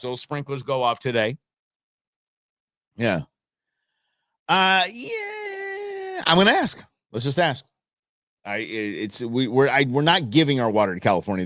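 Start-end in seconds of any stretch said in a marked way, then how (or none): 4.5-6.8 mock squeal. Host hamming up to the co-host, squeaky falsetto. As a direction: squeal it like a cartoon, then snap back. Sudden squeal then loud.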